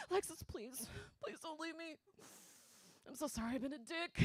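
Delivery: put-on high, soft pleading ex-boyfriend; begging an ex back. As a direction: whine it like a desperate ex. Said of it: "He's like".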